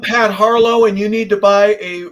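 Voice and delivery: lightly hammed-up insurance salesman; mock sales pitch. Host teases guest about selling insurance, mock salesman pitch.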